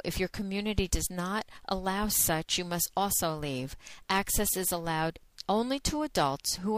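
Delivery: brisk, even legal-warning read; disclaimer read. Acted. Host reading the warning to listeners, matter-of-fact. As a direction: read the terms like a recorded notice. Reads the adult content disclaimer.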